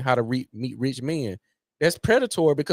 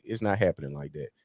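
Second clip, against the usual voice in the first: ordinary talk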